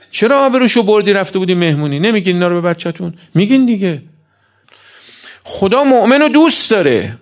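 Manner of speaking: high-pitched, insistent preacher's scolding; impassioned preaching. Acted. Preacher voicing God's and parents' reproaches to the congregation, impassioned.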